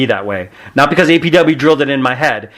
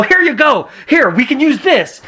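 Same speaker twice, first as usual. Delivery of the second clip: eager helper; 11 semitones higher than usual. Retold or imitated. Eagerly hands over a twig.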